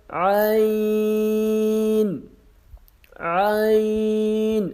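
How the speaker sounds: عین drawn from the throat with pressure; throaty long عین; pressed stretched Ain. Shown as a demonstration. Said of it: "pressure کے ساتھ کھینچ کے پڑھنا ہے".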